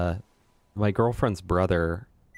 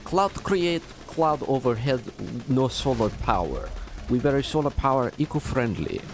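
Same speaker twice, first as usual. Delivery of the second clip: put-on Russian accent; 2 semitones higher than usual. Streamer ranting against clouds as Vladov, in a Russian accent. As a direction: rant like a Russian henchman in a thick accent. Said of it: "fine to do an accent?".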